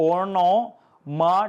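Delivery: "onomatopoeia" drawn out very slowly in parts; syllable by syllable. Shown as a demonstration. Teacher showing students the spelling, "onomatopoeia" sounded out slowly. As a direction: break "onomatopoeia" into long, separate syllables. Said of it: "spelling നോക്കി".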